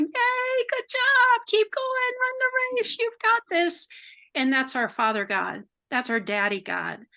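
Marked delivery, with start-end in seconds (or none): 0.0-3.9 much higher excited cheering, squeaky and bright; cheering crowd. Retold or imitated. Cloud of witnesses cheering the runner on, high and excited. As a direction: cheer someone on from the sidelines. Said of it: "everyone's cheering".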